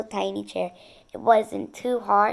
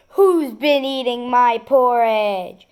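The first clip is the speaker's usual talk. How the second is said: slow, louder papa bear growl; big bear growl; 3 semitones higher, 8 dB louder, slower than usual. A narrator's reading. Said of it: "In his great big bear voice, he growled".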